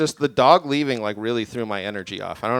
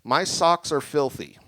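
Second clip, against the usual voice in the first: deadpan answer; 5 semitones higher, 5 dB louder, much slower than usual. Retold or imitated. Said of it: "and I just went".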